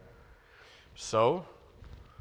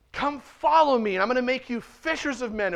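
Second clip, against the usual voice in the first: ringing call; 6 semitones higher than usual. Acted. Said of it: "his first calling of disciples".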